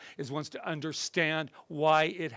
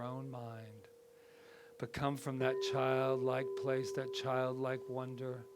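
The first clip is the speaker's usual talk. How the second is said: guided meditation; 7 dB quieter than usual. Acted. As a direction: ease them down into calm.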